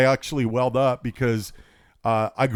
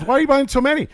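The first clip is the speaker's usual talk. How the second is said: cashier voice; 14 semitones higher, 5 dB louder, faster than usual. Retold or imitated. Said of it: "And she goes".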